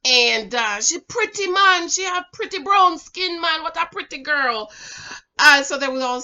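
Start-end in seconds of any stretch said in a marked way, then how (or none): 0.8-4.7 higher-pitched mock Jamaican accent; imitated Jamaican talk. Brothers' friend admiring a pretty girl, in a Jamaican accent. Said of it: "this how they talk".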